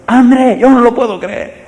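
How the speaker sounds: high, loud joyful shout of a name; old friend yelling in happy disbelief. Retold or imitated. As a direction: call out across the street in disbelief. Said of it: "y me grita".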